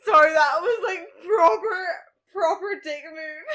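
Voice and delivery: loud laughing apology. Real, not acted. Laughs at own joke.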